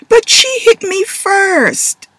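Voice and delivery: very high, slow, loud whine. Acted. Taylor whining to Dad after being scolded, high and slow. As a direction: stretch it out like a wronged kid. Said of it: "Taylor whined".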